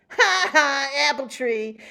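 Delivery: high taunting ha ha; mocking laugh. Boy jeers at Sam's sunflower, high mocking laugh. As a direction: jeer at them as you pass. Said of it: "a mocking voice called out".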